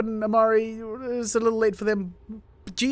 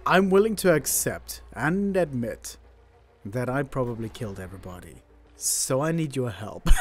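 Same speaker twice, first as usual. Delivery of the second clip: defeated admission; 8 semitones lower than usual. Real, not acted. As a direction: own up that you killed everyone. Admits defeat after colony dies.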